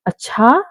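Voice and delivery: अच्छा with a steep rise on the last vowel; अच्छा swung upward into a question. Shown as a demonstration. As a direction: ask अच्छा? as if checking "really?". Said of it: "अच्छा word के उसने बहुत सारे वेज".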